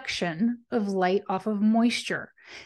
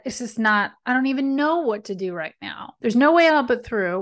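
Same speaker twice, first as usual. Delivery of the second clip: normal talking voice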